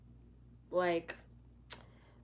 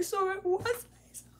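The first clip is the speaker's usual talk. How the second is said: mock panic; 5 dB louder than usual. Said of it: "Like, I'm freaking out".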